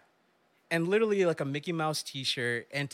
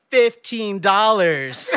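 slow and louder through laughter; laughing; 5 semitones higher, 10 dB louder, much slower than usual. Retold or imitated. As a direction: drag it out while cracking up. Teen names price, cracking up.

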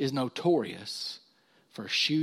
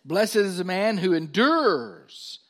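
high, loud, stretched pulpit reading; ringing preacher's declaration; scripture reading; 6 semitones higher, 7 dB louder, slower than usual. Acted. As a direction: proclaim the verse slowly and forcefully.

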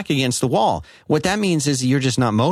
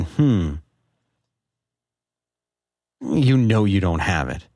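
slow, lower, mopey self-doubt; mocking defeatist voice; 6 semitones lower, slower than usual. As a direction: mimic a quitter's sigh. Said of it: "What kind of piss poor attitude is that?".

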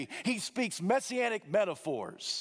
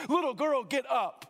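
commanding call; 8 semitones higher than usual. Acted. Jesus commands dead girl rise.

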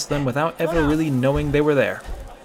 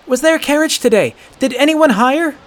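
put-on high, loud pleading boy; desperate boy; 14 semitones higher, 8 dB louder than usual. A narrator's reading. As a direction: beg for news urgently.

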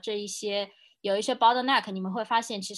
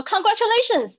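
imitating the boss, much higher and louder, bursting with joy; delighted congratulations; 13 semitones higher, 10 dB louder than usual. Boss congratulating his pregnant employee, high and loud with delight. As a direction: blurt it out like thrilled good news. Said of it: "他就非常非常高兴".

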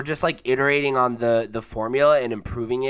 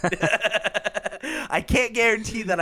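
laughing; 7 semitones higher, slower than usual. Real, not acted. Laughing at his own joke.

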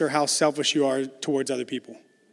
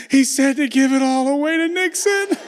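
put-on higher and louder, a choked-up kid's earnest answer; 9 semitones higher, 5 dB louder than usual. Teary son telling dad God's answer, earnest kid. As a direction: announce it like a brave little boy.

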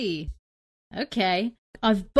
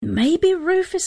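excited idea; 10 semitones higher, 6 dB louder, slower than usual. Real, not acted.

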